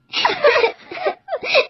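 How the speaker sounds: high-pitched acted sob; crying sob. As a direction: break into a high, whimpering cry. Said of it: "یہ کہہ کر وہ رونے لگی". (اونچی آواز میں سسکی)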